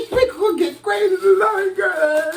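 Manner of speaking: very high, laughing squeal; high-pitched giddy laughter. Real, not acted. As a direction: squeal it through giggles. Giddy about the meal to come.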